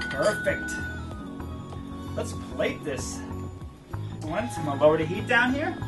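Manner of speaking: ordinary delivery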